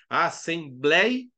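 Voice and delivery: ASSEMBLEIA slowly split, BLEI held; falling diphthong. Shown as a demonstration. Teacher listing examples, "Assembleia" slowly syllabified on BLEI. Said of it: "O E começa alto e cai pro I".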